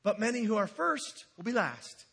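ordinary talk

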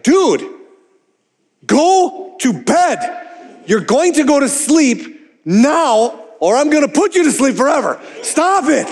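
mock-threaten the kids to sleep; exasperated dad. Exasperated dad ordering his kids to bed. Pastor voicing himself angry at kids.

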